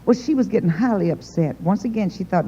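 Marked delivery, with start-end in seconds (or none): none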